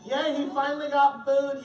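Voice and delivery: ordinary delivery, nothing marked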